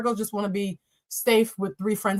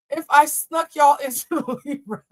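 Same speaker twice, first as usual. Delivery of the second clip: laughing; 5 semitones higher, 4 dB louder, slower than usual. Real, not acted. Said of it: "I don't mean to laugh".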